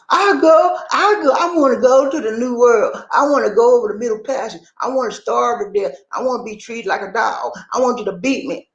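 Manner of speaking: high, sarcastic falsetto of an eager volunteer; mock the idea by making it sound silly and eager. Mocking the notion that Africans asked to be enslaved, high sarcastic voice.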